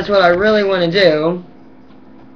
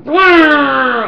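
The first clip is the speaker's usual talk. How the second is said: mock brrr; 6 semitones higher, 6 dB louder than usual.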